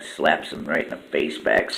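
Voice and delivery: ordinary delivery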